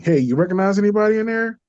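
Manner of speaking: lightly voiced angel, quick and upbeat; angel asking. The angel asking him, a lightly voiced quick question. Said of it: "he asked me".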